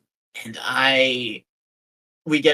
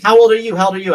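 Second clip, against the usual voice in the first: much higher, louder nagging demand; mock demanding; 8 semitones higher, 9 dB louder, faster than usual. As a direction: hound him about his age, mocking her. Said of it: "And she kept saying".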